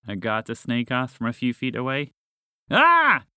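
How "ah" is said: short, high, loud scream; frightened scream. A narrator's reading. Frog startled by a snake, screaming. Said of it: "Wheezy screamed".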